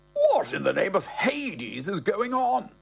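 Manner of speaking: put-on high, slow, affronted old gentleman; pompous old man. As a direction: demand it like an outraged old toff, slowly and high. Old man at airport alarm.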